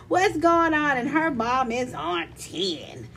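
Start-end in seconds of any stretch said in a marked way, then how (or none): 0.1-1.0 retold hollering. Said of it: "comes down from her room hollering".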